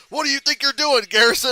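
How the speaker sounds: loud, high mob demand; storming in, yelling at Garrison; angry mob. Retold or imitated. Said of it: "And they're like".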